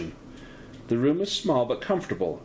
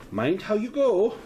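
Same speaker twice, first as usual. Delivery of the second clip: higher Celtic-lilt caution; fond hostess warning a guest; 10 semitones higher than usual. Acted. GM voicing May.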